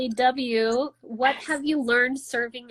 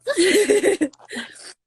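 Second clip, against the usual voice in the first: a high, loud laugh; a bright laugh that falls away; 8 semitones higher, 8 dB louder than usual. Real, not acted. Laughs while picking a question.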